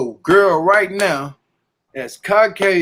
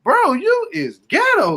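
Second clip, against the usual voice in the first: high, drawn-out incredulous retort; mock disbelief; 14 semitones higher, slower than usual. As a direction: react to her in high-pitched mock disbelief. Acted reaction to the Boston girl.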